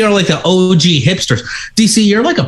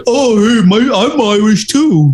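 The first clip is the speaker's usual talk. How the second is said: higher, slow put-on tourist voice; mock American tourist; 4 semitones higher, slower than usual. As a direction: gush like a clueless tourist. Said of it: "and be like".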